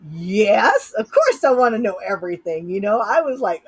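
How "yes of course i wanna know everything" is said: louder, eager acceptance; eager yes. Retold or imitated. Answering the alien's offer with an eager yes. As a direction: blurt out a keen yes.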